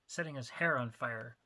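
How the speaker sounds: plain reading voice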